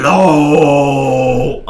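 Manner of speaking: loud tinkling fairy call. Pretend. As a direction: belt the little fairy hello. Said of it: "Okay, that's more accurate".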